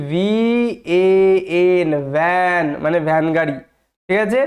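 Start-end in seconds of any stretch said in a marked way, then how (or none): 0.1-1.9 ভিএএন stretched out letter by letter, very slow; spelled out slowly. Shown as a demonstration. Teacher spelling the example word slowly for students. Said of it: "একটা example যদি দিই".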